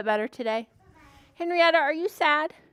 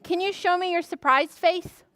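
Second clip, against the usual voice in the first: usual speaking voice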